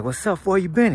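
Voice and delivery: acted friendly greeting. Acts out greeting an old acquaintance.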